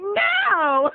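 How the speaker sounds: mocking girl voice. Girl's flat refusal.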